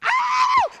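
high-pitched mock scream of fright; a hammed-up squeal; mock girly shriek. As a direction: shriek like a girl scared of the ball. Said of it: "girls all over the world will sit there like".

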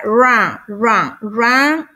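"Run" as "rahn", tongue off the palate; English R drill. Shown as a demonstration. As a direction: repeat "run" with untrilled R. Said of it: "que no toque su paladar".

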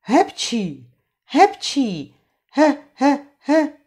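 rising run of sneezes; sneezing fit. Acted. Trautenberg sneezes with his cold, rising sneezes. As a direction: sneeze over and over. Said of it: "Rýma se zakuckala a prskat".

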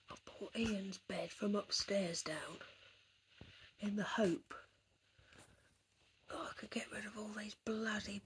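hushed nature-documentary narration; David Attenborough whisper. Acted. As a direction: whisper it like a wildlife presenter watching a shy animal. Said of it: "I don't know why I'm talking like David Attenborough".

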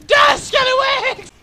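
high, stretched-out yell; slow, high war cry; battle cry. Pretend. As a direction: scream it as you attack. Attacking enemy in game.

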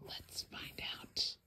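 a secret invitation; hushed whisper. A narrator's reading. Storyteller whispering to the child before turning the page. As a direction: whisper it conspiratorially.

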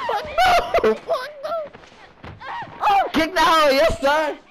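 loud, high frantic shouts; panicked shouting. Real, not acted. Streamer fighting off the enemy, shouting in panic. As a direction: yell wildly while struggling.

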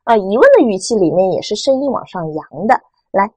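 ordinary delivery

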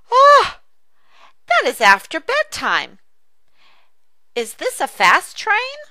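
put-on high, eager child asking; bright high child excitement; excited child voice. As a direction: squeal like a little kid begging. Dialogue, child voice.

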